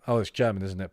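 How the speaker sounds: ordinary talk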